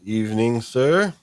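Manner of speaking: ordinary talk, nothing marked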